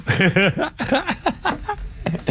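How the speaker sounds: long high giggle; high laugh. Real, not acted. Laughing at the AI joke.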